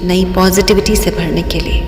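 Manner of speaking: guided meditation. Real, not acted. Guide leading listeners into breathing and relaxation, meditative. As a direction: lead listeners unhurriedly through each breath.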